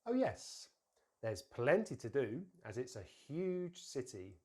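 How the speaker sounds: slow model sentence, "huge" lengthened; careful teacher's model, stressing "huge"; emphasis on HUGE. Shown as a demonstration. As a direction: stretch "huge", weak linked "to do". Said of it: "I'm gonna say the sentence".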